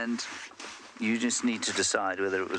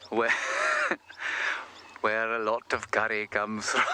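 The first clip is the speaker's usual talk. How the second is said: Welsh slipping into Mumbai; badly faked Welsh, breaking into laughter; failed Welsh accent. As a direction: attempt Welsh but land in an Indian accent, cracking up. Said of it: "That sounds like Mumbai".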